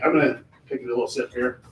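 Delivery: usual speaking voice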